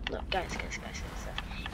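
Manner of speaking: urgent breathy whisper. Real, not acted. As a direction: hiss it to the viewers. Calls viewers to look, hushed.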